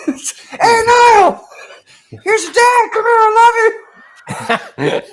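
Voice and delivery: very high, loud, drawn-out holler; gleefully mortifying falsetto dad call; embarrassing dad yell. Retold or imitated. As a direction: shout affection across a crowd, hammed-up. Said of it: "sometimes I'd be like".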